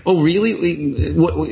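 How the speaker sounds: mock surprise. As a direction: feign shock to needle them. Friend mocking upset forum users.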